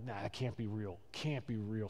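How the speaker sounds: low, soft, half-asleep parent; sleepy mumble. Acted. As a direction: play a drowsy parent dismissing it, barely voiced.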